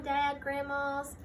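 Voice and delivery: normal talking voice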